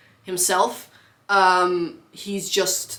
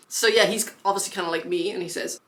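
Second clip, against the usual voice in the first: ordinary talk, nothing marked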